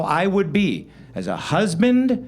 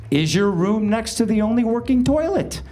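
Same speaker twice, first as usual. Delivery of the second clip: ordinary talk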